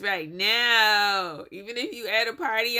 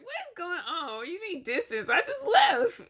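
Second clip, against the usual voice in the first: high-pitched mock bewilderment, breaking into laughter; imitating baffled boyfriend; 9 semitones higher than usual. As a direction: imitate a confused boyfriend in a squeaky voice. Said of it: "looking at his phone like".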